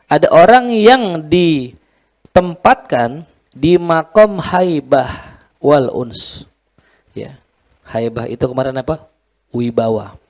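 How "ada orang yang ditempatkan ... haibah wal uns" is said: slow teaching delivery. Shown as a demonstration. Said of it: "explaining term slowly".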